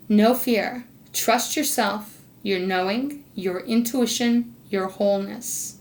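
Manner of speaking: slow, deliberate card reading. Real, not acted. Reader passes Spirit's card message to Sagittarius, slowed down. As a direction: give each phrase room, with weight. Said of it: "I'm gonna slow down and read this".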